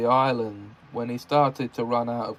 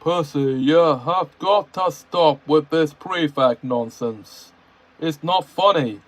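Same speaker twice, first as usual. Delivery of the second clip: stern Toby voice; 3 semitones higher, 4 dB louder than usual. A narrator's reading. Toby scolding Percy.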